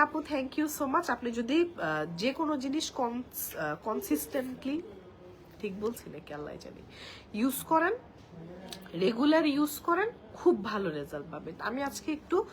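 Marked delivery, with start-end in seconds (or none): none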